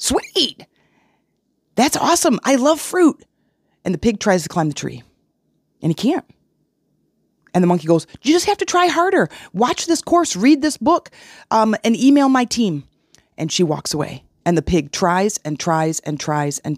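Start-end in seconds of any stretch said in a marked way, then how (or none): 0.0-3.2 high, squeaky excited pig; cartoon pig, high and delighted. Pig voiced in analogy story. 8.2-12.8 high, chirpy pushy monkey; perky guru monkey, voice pitched way up; monkey coach. Acted.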